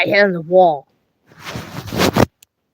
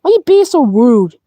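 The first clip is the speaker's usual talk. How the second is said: angry accusation; 4 semitones higher, 9 dB louder than usual. Acted. Puppets quarrel, accusing the other.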